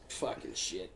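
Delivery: breathy under-the-breath swear through a laugh; whispered curse. Retold or imitated. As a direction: mutter it to yourself, half laughing. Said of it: "I'm like".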